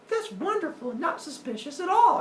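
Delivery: very high, mock-girlish delight; gullible girlfriend. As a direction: coo naively in a high female voice. Voicing the gullible goddess.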